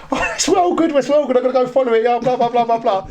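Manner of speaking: high-pitched mock excitement, rattled off; gushing fan. Retelling how officers praised them, in mock gushing. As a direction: send up an overexcited fan.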